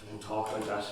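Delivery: quiet him tenderly; hushed plea. Acted. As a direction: son softly begging his dying father. Son comforting dying father.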